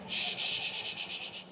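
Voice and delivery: long soft string of shushes; shushing. Real, not acted. Host quieting the awards audience, drawn-out shushing.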